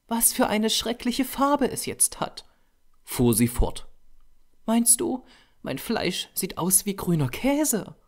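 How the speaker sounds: put-on high-pitched voice for a dismayed young model; Tessie's character voice. A narrator's reading. Model questioning the painter about the awful color, high and dismayed. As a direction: lift far above the narrating voice, dismayed.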